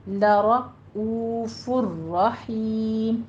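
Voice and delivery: لَرَؤُوفٌ رَحِيمٌ very slow, lips round on the long o. Shown as a demonstration. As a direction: recite لَرَؤُوفٌ رَحِيمٌ slowly, o drawn and rounded. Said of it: "دونوں ہونٹوں کو گول کیجیے".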